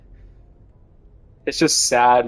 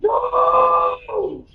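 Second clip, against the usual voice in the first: shrill protesting cry, much higher; high shouted no; 3 dB quieter, much slower than usual. Real, not acted. Outraged at Dennis taking the girlfriend, high shouted no. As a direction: scream no in protest.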